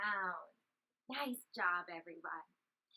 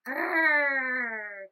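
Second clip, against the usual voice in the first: lion roar; 5 dB louder than usual. Acted. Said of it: "he roared as hard as he could".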